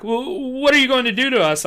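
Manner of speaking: high loud fearful plea; frightened cry. Acted. Cornered kid crying out at the approaching man, frightened. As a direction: plead with rising fear. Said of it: "I cried".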